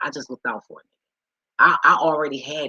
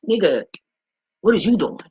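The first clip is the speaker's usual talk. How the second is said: loud, high exasperated demand; re-enacted outraged scolding; indignant challenge; 5 semitones higher, 7 dB louder than usual. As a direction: call him out sharply. Retold scolding over her money.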